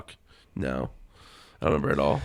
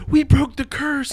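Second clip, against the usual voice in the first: cheering; 12 semitones higher, 6 dB louder, much slower than usual. Retold or imitated. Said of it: "everyone in school was like".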